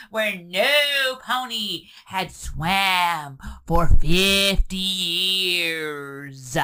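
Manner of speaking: low, slowing, eerie storytelling voice; spooky storyteller. Acted. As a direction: draw out fifty years like a ghost story. Spooky legend told to a friend.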